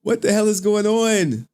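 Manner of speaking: high-pitched bewilderment; baffled exclamation. Real, not acted. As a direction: exclaim it high, thrown by the glitch. Puzzled by something failing on stream.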